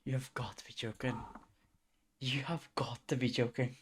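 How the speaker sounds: soft, breathless whisper of disbelief; whispering it under your breath; disbelieving whisper. Real, not acted.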